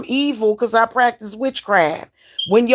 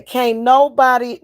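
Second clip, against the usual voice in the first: ordinary delivery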